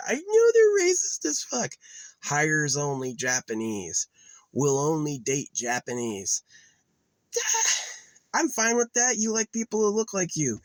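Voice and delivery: high, loud exasperated rant; heated rant. Real, not acted. Heated rant about racism.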